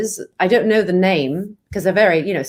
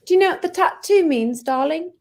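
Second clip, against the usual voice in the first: higher, teasing British "darling" voice; British accent; 6 semitones higher than usual. Pretend. Actor quizzes Lim about the tattoo, British accent. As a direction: ask it as a theatrical Brit. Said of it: "my British accent".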